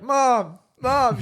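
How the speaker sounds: high calls of Mom; calling out. Retold or imitated.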